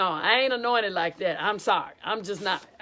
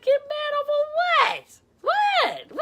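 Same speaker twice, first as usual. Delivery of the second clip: very high-pitched mock indignation; defensive disbelief; slower than usual. Director answering a nervous choir member, in high mock indignation. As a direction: squeal it in acted disbelief.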